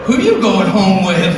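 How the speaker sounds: high, loud, slow catty hollering; tipsy girls teasing at the bar; loud mock party-girl shrieks; rowdy drunk women. Imagined women at trough.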